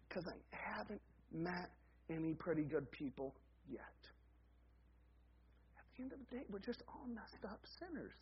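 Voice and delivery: quiet, sober pulpit delivery; hushed preaching. Acted. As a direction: drop to a very soft, confiding level. Hushed sober turn in sermon.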